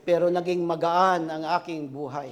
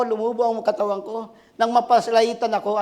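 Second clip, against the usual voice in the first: ordinary delivery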